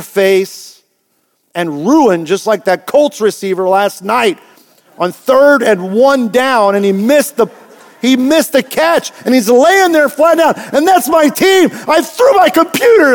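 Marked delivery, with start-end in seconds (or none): 5.0-13.1 rising higher and louder, mock exasperation; fan's mock frustration. Fan retelling his team's missed catch, mock exasperation.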